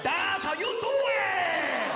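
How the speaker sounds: high, very drawn-out put-on voice; approving comic's voice. Reaction to the requested impression, high slow caricature.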